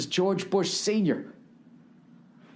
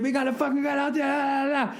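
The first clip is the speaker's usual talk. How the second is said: fast, much higher hype yell; mock yell; 8 semitones higher, faster than usual. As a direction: rattle it off like a screaming hype man. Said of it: "He yelled some shit".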